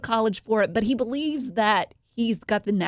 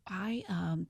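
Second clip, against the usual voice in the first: whisper; 3 semitones lower, 14 dB quieter than usual. Retold or imitated. Girl's nervous confession, retold.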